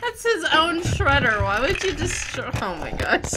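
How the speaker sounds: can't believe what you just saw; loud disbelief. Real, not acted. Vlogger reacting loudly to the smashed shredder.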